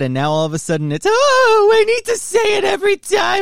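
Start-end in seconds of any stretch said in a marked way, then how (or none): 1.1-3.4 much higher, louder whiny mock of his co-host; teasing the co-host with a shrill mock voice; mocking Scott. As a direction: squeak it out like a fussy worrier. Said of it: "one person told Scott that".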